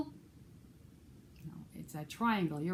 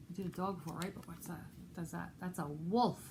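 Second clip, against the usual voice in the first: ordinary delivery, nothing marked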